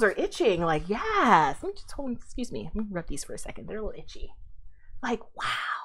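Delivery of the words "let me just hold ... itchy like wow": much softer, half under the breath; quiet aside. Real, not acted. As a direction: mumble it away from the mic. Muttered aside off mic.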